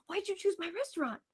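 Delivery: a hint of a delighted owner asking; eager owner. Owner asking tourists why they came, eager curiosity.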